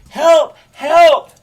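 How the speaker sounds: high, loud shouts for help; two urgent, high-pitched hollers; cry for help. Acted. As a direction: yell out as if from offstage. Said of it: "within offstage meaning, you have, you hear the words".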